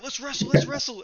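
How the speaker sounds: high, eager let's wrestle; lightly voiced keen drunk; eager drunk challenge. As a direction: egg him on like a drunk mate. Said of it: "he's like".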